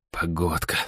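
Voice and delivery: soft grumble to oneself; буркнуть под нос. A narrator's reading. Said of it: "буркнул я себе под нос". (тихо буркнуть себе под нос)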